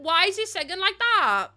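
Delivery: loud, high baffled question; exasperated disbelief. Real, not acted. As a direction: cry out in comic outrage. Baffled reaction to the singing.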